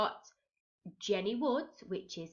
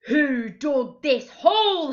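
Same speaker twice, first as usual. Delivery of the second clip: loud, higher, slow bellow; 6 semitones higher, 10 dB louder, slower than usual. A narrator's reading. Captain in the hole demands who dug it, loud slow bellow. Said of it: "he bellowed".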